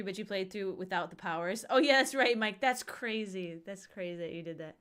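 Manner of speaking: ordinary talk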